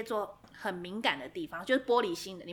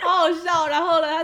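laughing; 6 semitones higher, 9 dB louder than usual. Real, not acted. Cracking up at the story.

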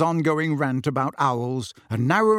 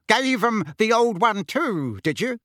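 hearty uncle; 8 semitones higher than usual. A narrator's reading. Uncle asks his son about the fight, hearty. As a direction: egg the boy on in a high-pitched, hearty voice.

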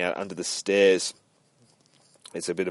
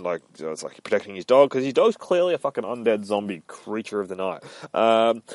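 plain reading voice

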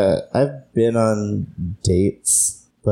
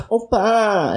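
mock whiny girlish "oppa", pitched up; 12 semitones higher than usual. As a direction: imitate a whiny plea, drawing out "oppa". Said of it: "it's the really whiny".

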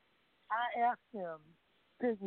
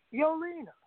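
higher-pitched spoken call; calling out a greeting; 7 semitones higher than usual. Retold or imitated. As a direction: hail someone entering the room. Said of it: "And Tim says".

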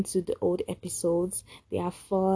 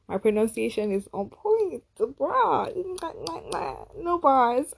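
ironic zoomer; 6 semitones higher than usual. Pretend. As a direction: toss off the slang for fun. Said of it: "my favorite line right now".

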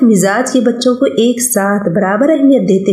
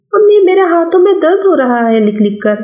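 put-on higher boyish voice, brushing mother off; a busy schoolboy refusing food, a bit higher; young boy; 9 semitones higher than usual. Son refusing his mother's call to lunch, boyish and higher. Child Afaq complaining.

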